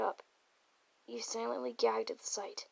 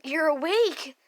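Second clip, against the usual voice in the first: high, loud burst of delight; joyful scream; 5 semitones higher, 10 dB louder, slower than usual. Acted. Nagito seeing you wake up, joyful high cry. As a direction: cry out overjoyed, swooping up. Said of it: "He screamed with joy".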